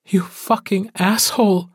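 wife lashing out at her returned mate, furious; furious curse. A narrator's reading. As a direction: spit the insult out in rage. Furious wife cursing dead mate.

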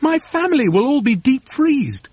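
lightly voiced anxious monkey; Mugglewump crying out. A narrator's reading. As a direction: wail it mildly in worry. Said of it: "cried Mugglewump".

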